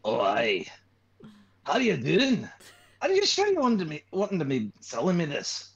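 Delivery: put-on telemarketer-prank voice. Shown as a demonstration. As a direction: pester a telemarketer in a convincing fake voice. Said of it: "convincingly do the voice to a telemarketer".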